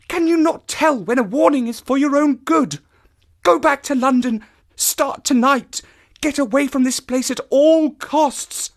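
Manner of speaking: high, loud, pleading woman; urgent cry. A narrator's reading. Woman cries out for him to flee, urgent. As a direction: press the warning home with force. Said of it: "she cried".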